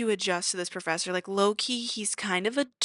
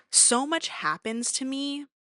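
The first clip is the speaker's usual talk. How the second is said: ordinary talk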